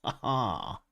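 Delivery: short gloating villain laugh. Pretend. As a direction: cackle like a cartoon baddie. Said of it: "That's my villain laugh".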